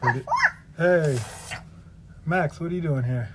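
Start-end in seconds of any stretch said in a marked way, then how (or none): none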